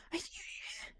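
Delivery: breathy whisper from the dark; whispered question. Acted. A voice in the dark whispers to the newcomer. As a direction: ask it in a hush, searching.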